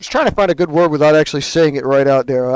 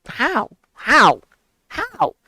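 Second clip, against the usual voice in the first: incredulous how; 8 semitones higher, much slower than usual. Real, not acted. Disbelief at careless coworkers.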